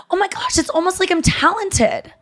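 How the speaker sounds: high, mock-sweet sarcasm with a fake laugh; sarcastic brag. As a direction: gloat at her in a playful, pointed way. Sarcastic reply to the handler.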